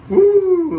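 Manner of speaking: high, loud whoop of delight; excited cheer. Real, not acted. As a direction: cheer the jet on at full voice. Cheering the stunt jet pass.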